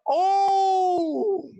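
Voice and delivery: high, drawn-out wail of dread; mock terror. Student at the plane door on one, high terrified wail. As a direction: wail in exaggerated fear at the count.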